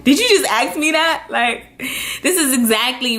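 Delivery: half-shouted disbelief, peaking high at the end; yelping at the cousin in disbelief; mock scream. Retelling yelling at her cousin over the phone, mock scream. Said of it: "kinda, like, screamed".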